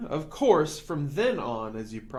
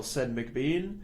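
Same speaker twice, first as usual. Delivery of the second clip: ordinary delivery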